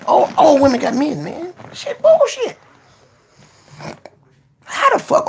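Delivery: high, loud frustrated outburst; exasperated outburst. Real, not acted. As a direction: throw up your hands and yell it high. Frustrated rant on stream.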